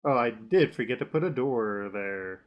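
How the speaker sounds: ordinary talk, nothing marked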